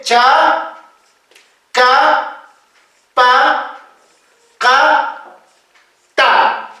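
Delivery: "cha, ka, pa, ka, ta" with plain stops, one by one; no puff or pop on "cha, ka, pa, ka, ta"; simple consonants. Shown as a demonstration. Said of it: "las consonantes simples". (consonantes simples, sílaba por sílaba)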